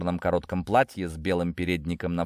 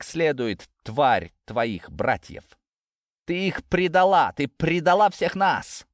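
denouncing; 7 semitones higher than usual. A narrator's reading. Father denouncing daughter.

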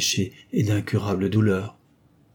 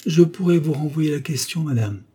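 disdainful smile; 7 semitones higher, 4 dB louder than usual. A narrator's reading. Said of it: "en souriant dédaigneusement".

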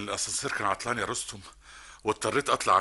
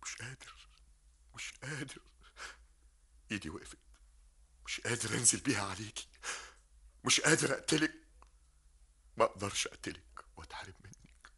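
soft, hushed, faltering; he can't go through with it; broken whisper; 8 dB quieter than usual. Acted. Medhat on tape, unable to kill Sabah. Recorded man breaks down.

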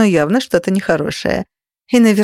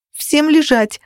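high-pitched bold order; higher, brazen command; brazen yell; 8 semitones higher, slower than usual. A narrator's reading. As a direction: yell it with cheeky nerve. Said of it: "заорала я, набираясь наглости".

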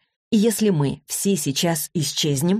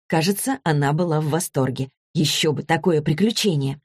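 ordinary delivery

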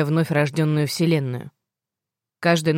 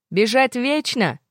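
shouting over the noise; 7 semitones higher, slower than usual. Acted. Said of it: "попыталась перекричать окружавший гвалт".

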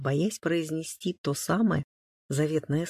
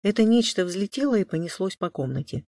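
ordinary delivery

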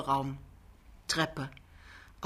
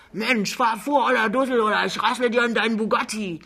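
loud, higher angry yell; wütendes Anbrüllen; 4 semitones higher, 5 dB louder than usual. Acted. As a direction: bellow a threat at the driver ahead. Wartender Fahrer schimpft den Chauffeur an. (lautes, wütendes Gebrüll)

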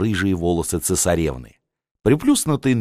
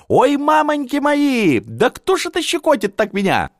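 squealing. Acted. Tsarevna Elizabeth squeals in the dark when someone tickles her, high playful shriek. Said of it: "визжала цесаревна Елизавета".

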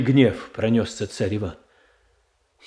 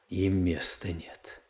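grim whisper; 5 semitones lower, 10 dB quieter than usual. Acted. Boris condemns the Shuiskys.

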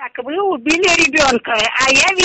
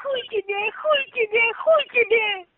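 shrill jeering refusal; angry; 10 semitones higher, 3 dB quieter than usual. Real, not acted. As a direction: taunt back near tears. Taunting refusal, voice cracking.

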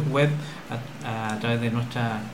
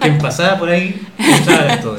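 words through a laugh, a bit louder; 4 semitones higher, 9 dB louder than usual. Real, not acted. Interviewer laughing as she teases about recruiting anyone. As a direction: chuckle while teasing.

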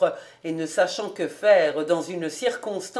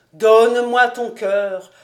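louder mock-mournful wail; louder lamenting plea; 7 dB louder than usual. A narrator's reading. Fritz lamenting his love song, louder mock wail. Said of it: "d'un ton lamentable".

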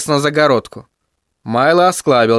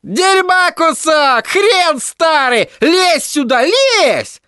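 высокий мальчишеский задиристый крик, медленно; задирай старика через забор; Тедди раззадорился; much slower than usual. Acted. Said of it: "Тедди разошёлся не на шутку". (Тедди задирает Майло, дразняще кричит)

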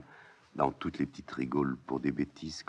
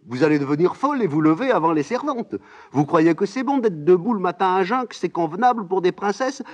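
much higher and louder, fussing and scolding; scolding old nurse; 13 dB louder than usual. Acted. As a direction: fuss over the girls like an alarmed nanny. Nurse scolding both princesses.

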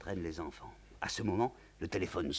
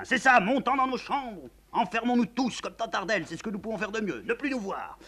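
crying out; 13 semitones higher, 9 dB louder than usual. Acted. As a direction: burst out at the whole family. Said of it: "Le comte crie soudain".

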